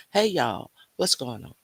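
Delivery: usual speaking voice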